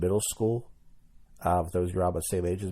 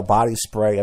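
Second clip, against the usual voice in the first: normal talking voice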